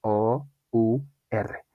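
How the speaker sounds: slow letter-by-letter spelling; deliberate, separated letters. Shown as a demonstration. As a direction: say each letter apart, with gaps. Teacher spells out a word.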